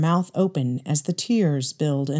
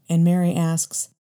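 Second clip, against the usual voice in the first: ordinary delivery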